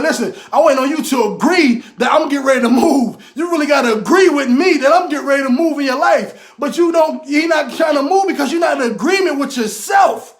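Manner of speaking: fired-up motivational preaching; preaching at full force. Acted. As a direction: preach it hard and drive every question home. Preaching God waiting on you.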